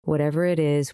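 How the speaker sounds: usual speaking voice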